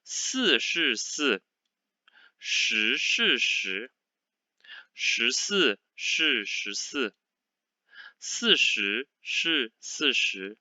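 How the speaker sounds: connected syllables, clear careful tones; normal-speed reading. Shown as a demonstration. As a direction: read each sentence straight through, measured. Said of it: "in a normal speed".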